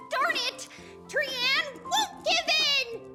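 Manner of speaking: high, frustrated refusal to yield; cornered but defiant, voice high; defiant outcry. Acted. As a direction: cry out stubbornly, drawing out the last words.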